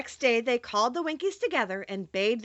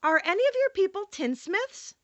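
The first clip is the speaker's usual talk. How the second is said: lightly voiced young girl, pitched up; a hint of an eager little girl asking; Dorothy's voice; 9 semitones higher than usual. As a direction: read Dorothy a little higher and brighter. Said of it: "Dorothy said to the Winkies".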